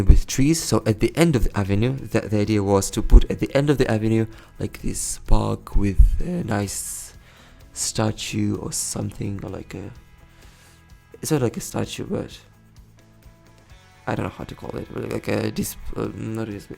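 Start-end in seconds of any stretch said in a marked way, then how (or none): none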